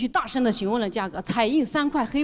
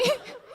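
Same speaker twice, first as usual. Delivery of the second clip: high giggle; 12 semitones higher, 5 dB quieter than usual. Real, not acted. 笑出声.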